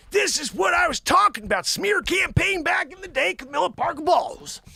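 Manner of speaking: high, shouted outburst; worked-up rant. Acted. Host telling Joanne about smear campaign, worked-up rant. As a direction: cry it out high in mock outrage.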